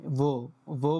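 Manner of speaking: ভো as one syllable with a round "o"; correct pronunciation. Shown as a demonstration. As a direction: say ভো slowly, no "ay" sound in it. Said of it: "এখানে কিন্তু বে বলি নাই আমি".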